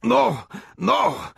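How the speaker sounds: very high, choked no; hanged man gasping denials; gasped denial. A narrator's reading. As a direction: beg through a crushed throat. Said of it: "gasped the hangman".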